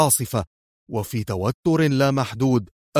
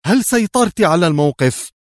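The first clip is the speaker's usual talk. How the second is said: high, raised-voice urgent question; calling out; 6 semitones higher, 4 dB louder than usual. A narrator's reading. Woman leaping from the opening, calling out to her comrade. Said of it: "هاتفة".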